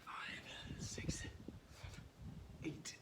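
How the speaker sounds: breathless whispered counting; gasping between reps; out of breath. Real, not acted. Winded coach counting reps.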